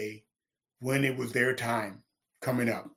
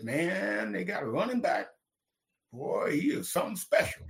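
softer, higher hushed admiration; awed praise; 6 semitones higher, 6 dB quieter than usual. Retold or imitated. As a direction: imitate people marvelling, softly and higher. Others praising Payton, retold.

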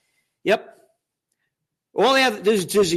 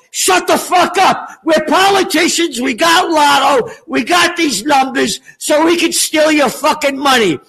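mock angry politicians; 4 semitones higher than usual. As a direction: boast brazenly as crooked politicians shouting people down. Acts out brazen politicians silencing critics.

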